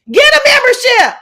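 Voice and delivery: high, loud mocking voice; shrill mocking shout. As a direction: yell it up high like a brat. Mimicking Lemon on live.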